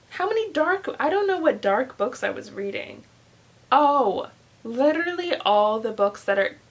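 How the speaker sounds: usual speaking voice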